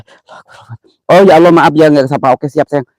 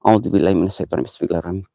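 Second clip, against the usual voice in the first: hushed quick prayer; 8 semitones lower, 7 dB quieter, much faster than usual. Real, not acted. Hushed prayer before entering.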